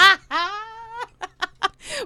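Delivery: high cackling laugh; witch cackle. Real, not acted. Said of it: "my fucking witch cackle".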